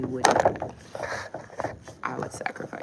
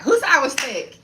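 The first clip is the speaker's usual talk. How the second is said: loud, higher, sassy demand; playful challenge; 11 semitones higher, 11 dB louder than usual. Real, not acted. Challenging viewers who called her thick, loud and sassy. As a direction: call them out playfully.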